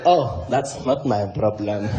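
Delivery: very low, slow, chuckling dismissal; Jacob Zuma. Acted. Zuma waves away complaints about his puppy, deep amused mock voice. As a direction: shrug it off in a deep voice with a slow laugh. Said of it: "And he was like".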